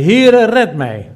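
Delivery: hoog, luid en langzaam uitgeroepen; noodkreet. Acted. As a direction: roep het uit als een zinkende man. Petrus roept terwijl hij zinkt. (luide, langzame hulproep van Petrus)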